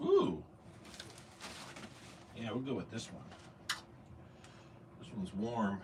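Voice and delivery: talking while pulling a shirt on; quiet, muffled words mid-change; while getting dressed. Real, not acted. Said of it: "I'm gonna do a costume change real quick".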